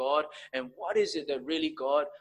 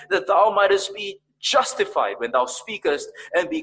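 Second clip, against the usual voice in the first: loud, high declamation of a psalm; raised-voice, impassioned Bible recitation; declaimed scripture; 7 semitones higher, 8 dB louder than usual. Retold or imitated. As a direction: proclaim the verse from the pulpit.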